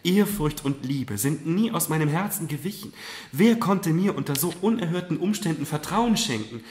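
high, loud, heartfelt protest; fervent reassurance. A narrator's reading. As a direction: reassure your mother with feeling. Daughter fervently reassures her mother.

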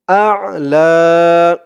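আ'লা with the final a stretched very long; long drawn vowel. Shown as a demonstration. Teacher drawing out the long vowel of আ'লা. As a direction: hold the long a of আ'লা far out. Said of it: "যেমন টান দিব".

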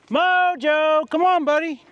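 high, loud call to the dog. Real, not acted. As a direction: call him from across the yard. Calling the dog.

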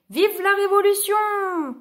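high, drawn-out revolutionary shout; rousing storybook rallying call; rallying cry. A narrator's reading. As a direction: cry it out slowly to the crowd. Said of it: "lui crièrent des paysans armés".

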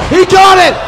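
high, excited move call; excited play-by-play. Real, not acted. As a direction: shout it as the move lands.

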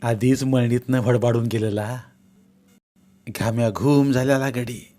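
usual speaking voice

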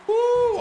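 sharp high joyful whoop. Real, not acted. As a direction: let out a big celebratory woo. Celebrating a big hit.

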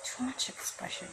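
soft whispered aside; hushed whisper to the viewer. Real, not acted. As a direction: breathe it under your breath. Whispered aside while watching ad.

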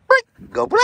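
high, loud cheerful command; excited release cue. Real, not acted. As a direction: pop the word out brightly.

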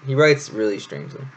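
ordinary delivery

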